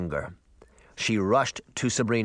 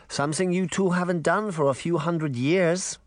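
put-on higher granny voice, light German accent; 7 semitones higher than usual. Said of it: "in her light German accent".

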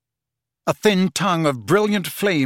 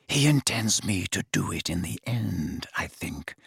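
1 slow and low; 6 semitones lower, 5 dB quieter than usual. A narrator's reading. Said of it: "he said slowly".

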